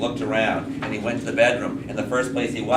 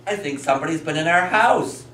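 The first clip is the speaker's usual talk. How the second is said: higher-pitched, lightly voiced storybook father; father in story; 7 semitones higher than usual. Father tells his wife of an intruder, lightly acted concern. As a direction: call to your wife with mild alarm. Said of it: "he goes like this".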